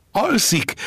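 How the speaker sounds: loud, very high-pitched yell; shouting back. Acted. Boy answers the fishermen from afar, shouting back. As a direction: holler it back in one high burst. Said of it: "kiabálta vissza a fiú".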